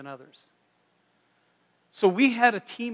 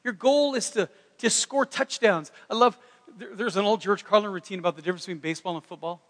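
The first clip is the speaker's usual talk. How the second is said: usual speaking voice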